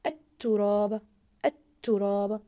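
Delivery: "التراب" loud, silent ل and doubled ت; stressed doubled letter. Shown as a demonstration. Teacher modelling the doubled ta, stressed doubled letter. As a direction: punch the held ت in "التراب". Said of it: "ضاعفنا حرف التاء في كلمة التراب".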